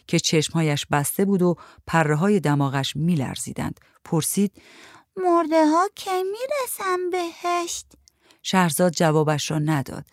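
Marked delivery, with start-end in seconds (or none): 5.2-7.9 put-on little girl voice. A narrator's reading. Little girl asks when the dead reach heaven, high and slow. Child character voiced by reader.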